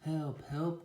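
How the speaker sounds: high, loud storybook cry; hammed-up high cry for help; cried for help. A narrator's reading. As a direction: call out as a little truck in trouble. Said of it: "cried Little Blue Truck".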